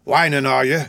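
irritable bullying question; scolding Sikes. A narrator's reading. Sikes rounds on Nancy after striking her, scolding. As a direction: scold with contempt.

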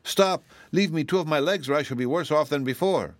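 cry of alarm. Acted. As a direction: panic as the magic goes too far. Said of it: "cried out in alarm".

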